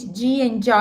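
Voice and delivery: ordinary talk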